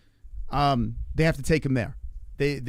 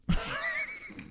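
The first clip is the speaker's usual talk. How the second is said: high, soft laugh; real laugh at the absurdity; 7 dB quieter than usual. Real, not acted. Storyteller laughing at outrunning the ambulance, high laugh.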